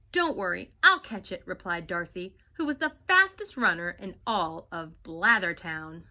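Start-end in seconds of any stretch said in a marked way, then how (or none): 0.1-1.5 loud, eager promise; bright confident I'll; eager reassurance. Acted. Darcy promises to catch it.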